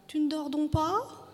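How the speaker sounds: high, slow wifely question; high-voiced, tentative asking. A narrator's reading. Wife questions husband in bed.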